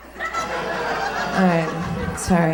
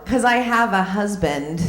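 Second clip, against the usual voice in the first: ordinary talk, nothing marked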